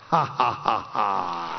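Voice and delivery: slow, low hearty laugh. Real, not acted. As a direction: laugh it off warmly at the punchline. Preacher laughs at the point.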